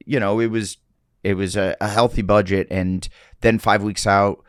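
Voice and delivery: usual speaking voice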